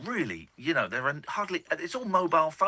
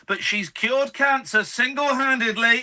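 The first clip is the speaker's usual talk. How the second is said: hyped announcer voice; 8 semitones higher, 7 dB louder than usual. Acted. Host hypes caller like announcer.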